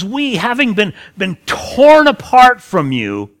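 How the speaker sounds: wrench out "torn apart"; anguished emphasis. Shown as a demonstration. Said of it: "not a placid expression".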